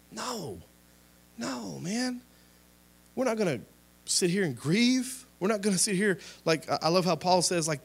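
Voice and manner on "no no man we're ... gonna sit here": high, climbing preacher's insistence; fiery rebuttal. Real, not acted. Preacher rejecting giving up, insistent and higher. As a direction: refuse it with rising fire.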